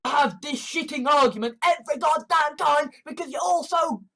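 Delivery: fed-up, heated tirade; exasperated rant. Real, not acted. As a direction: rant at the top of your range. Exasperated rant about constant arguments.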